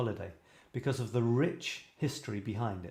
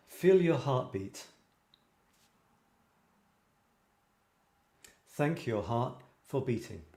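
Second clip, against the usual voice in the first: slow meditation guide; guided meditation; slower than usual. Acted. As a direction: lead them calmly through it. Leads gratitude meditation.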